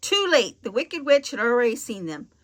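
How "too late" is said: usual speaking voice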